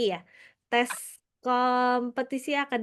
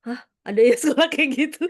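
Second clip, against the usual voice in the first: louder, laughing disbelief; mock astonishment; 2 semitones higher, 5 dB louder than usual. Parent hearing of an unusual school, laughing astonishment. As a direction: blurt it out in amused disbelief. Acting surprise at unfamiliar school.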